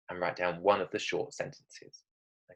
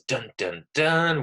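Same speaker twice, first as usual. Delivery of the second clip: mock-ominous, final note jumping high and loud; dramatic suspense sting; 5 semitones higher, 3 dB louder, slower than usual. Shown as a demonstration. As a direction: punch out a cliffhanger sting.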